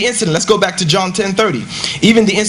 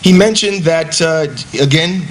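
normal talking voice

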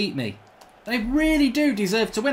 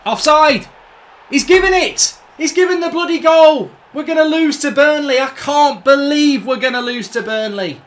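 loud, outraged yelling at the referee's decision; furious shouting; 5 semitones higher, 9 dB louder than usual. Real, not acted. Gamer yelling at the screen as Burnley score, furious shout. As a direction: bellow it, disbelieving and livid. Disputed goal against his team.

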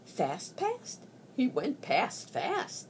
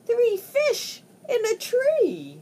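excited; 11 semitones higher, 5 dB louder than usual. Acted. Excited reading of fish in tree.

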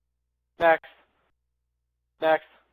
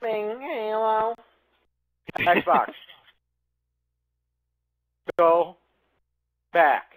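slow flat robot voice; 6 semitones higher, 4 dB louder, much slower than usual. Real, not acted. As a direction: speak like a robot. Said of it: "talk like a fucking robot".

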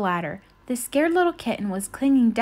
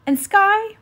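calling out; 10 semitones higher, 6 dB louder than usual. A narrator's reading. Ryder calling pup.

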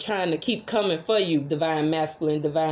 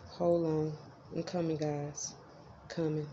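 called out from across the room, much softer; far from the mic; 4 semitones lower, 13 dB quieter than usual. Real, not acted. Tarot reader calling to viewers from across the room, far off. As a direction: step away and call back to the mic.

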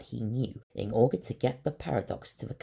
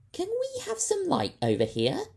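high, louder call across the room; 7 dB louder than usual. Acted. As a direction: call to the robot in the corner. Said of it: "she called".

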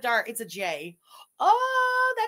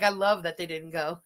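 usual speaking voice

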